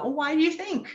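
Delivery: high, gentle asking; curious question to child. Shown as a demonstration. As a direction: coax an answer from a child. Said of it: "You can ask them about".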